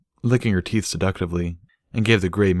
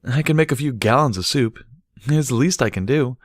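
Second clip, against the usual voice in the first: higher, louder friendly mare voice; Barry, eager host; 8 semitones higher, 4 dB louder than usual. Acted. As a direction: offer it brightly, pleased to help. Barry offers to cook.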